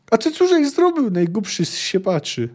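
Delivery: high, scolding outburst; screechy old man's tirade. A narrator's reading. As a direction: screech reproach like an outraged elder. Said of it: "rozrzeszczał się na dobre starzec".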